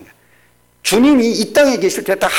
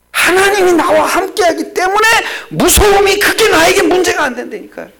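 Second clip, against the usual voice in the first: preaching; 10 semitones higher, 4 dB louder than usual. Acted. Preaching at full force.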